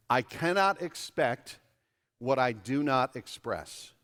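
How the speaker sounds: slow and deliberate, each phrase set apart; slow emphatic. Shown as a demonstration. Pastor giving the congregation a sentence to note, slow and deliberate. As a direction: dictate it slowly so they can write it down. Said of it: "a sentence to write down on your connection card".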